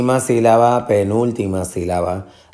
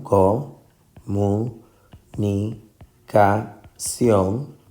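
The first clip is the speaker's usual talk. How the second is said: comunicación very slowly split, co... mu... ni... ca... ción; long pause after each syllable of comunicación; much slower than usual. Shown as a demonstration. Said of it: "La sílaba tónica en comunicación". (comunicación muy lenta, sílaba por sílaba)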